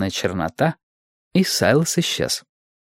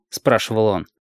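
plain reading voice

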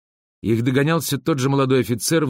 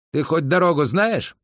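mild shout, higher pitch; calling out; 6 semitones higher than usual. A narrator's reading. Stepan drawing level with Boris on horseback, mildly shouted question. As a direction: raise the question as if over hoofbeats. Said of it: "прокричал Степан".

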